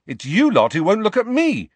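loud, high angry retort. A narrator's reading. Harry turns the accusation on his friends, angry. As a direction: throw the blame back angrily. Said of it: "said Harry angrily".

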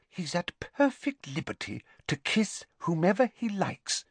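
haughty schoolgirl feigning indifference; prim Hermione. A narrator's reading. Voices Hermione, whispering in library.

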